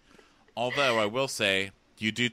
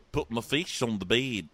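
mock New Zealand accent coaching; patient Kiwi accent demonstration; put-on New Zealand vowels; 3 semitones higher than usual. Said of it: "Let's work on that".

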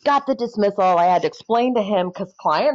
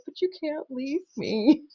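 small, high, slow mock-tearful plea; soft, drawn-out retold whimper; imitating bawling; 6 semitones higher, 6 dB quieter than usual. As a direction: imitate a client pleading through tears. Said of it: "he is just bawling".

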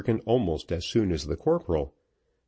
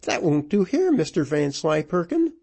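high-pitched scolding widow; mounting indignation, pitched high; working into rage; 8 semitones higher than usual. A narrator's reading. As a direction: tell him off in a high, indignant voice. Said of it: "working herself into a rage".

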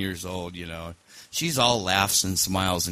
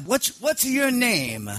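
high-pitched, slow mock Sicilian accent; Sicilian mob boss; 13 semitones higher, slower than usual. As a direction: put on an old Sicilian don's accent. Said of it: "Sicilian guy in the middle".

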